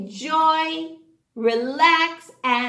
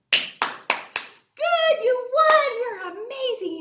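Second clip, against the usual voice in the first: much higher cheering praise; excited praise; 11 semitones higher, slower than usual. Real, not acted. Teacher telling kids they won, high excited cheer. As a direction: cheer them for winning.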